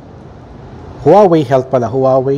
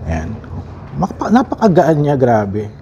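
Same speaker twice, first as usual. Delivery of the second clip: ordinary delivery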